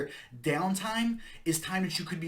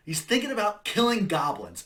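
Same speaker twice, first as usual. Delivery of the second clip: louder and higher, punching "thinking" and "killing goblins"; forceful correction; 6 semitones higher, 6 dB louder than usual. Acted. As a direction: drive the point home with a near-shout.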